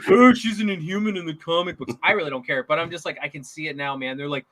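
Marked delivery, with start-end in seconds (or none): 0.1-1.9 mock nerd outrage; whiny geek fan. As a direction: put on a pedantic fanboy's complaining voice. Mocking complaining comic fans.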